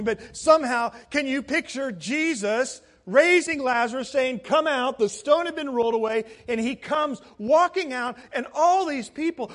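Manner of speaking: high, loud, excited storytelling; impassioned pulpit build-up; preach. Real, not acted. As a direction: paint the scene with mounting fervour. Preaching at full force.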